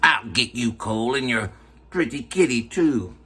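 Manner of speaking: lightly voiced witch threat; a hint of a cackling witch; Wicked Witch voice. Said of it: "Quoting the Wizard of Oz".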